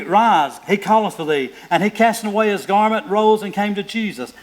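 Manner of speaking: loud, high proclaiming; preaching scripture. Acted. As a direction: announce the call like a herald. Preaching at full force.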